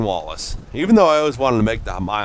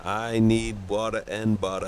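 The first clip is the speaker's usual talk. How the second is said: put-on softer Boston accent try; attempted Boston accent; 8 dB quieter than usual. Friend repeating on command, softer attempted Boston accent. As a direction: echo it softly in Boston. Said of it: "Say it".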